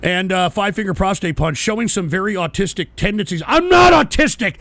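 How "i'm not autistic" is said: high, loud denial; real angry protest; indignant shout. Real, not acted. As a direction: yell back at the taunt. Denies a chatter's taunt.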